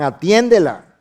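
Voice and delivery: loud, pressing appeal; urgent pleading. Retold or imitated. Disciples begging Jesus to answer the woman, loud plea. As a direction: urge him to deal with her. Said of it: "le rogaban".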